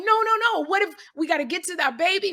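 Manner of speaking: wife begging the husband to leave the door unlocked, mock alarm; mock panicked mother. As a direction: plead like a worried mom, hammed up. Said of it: "And you're like".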